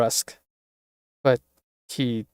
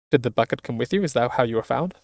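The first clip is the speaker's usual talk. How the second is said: ordinary talk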